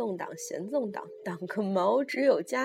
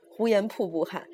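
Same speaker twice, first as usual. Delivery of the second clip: plain reading voice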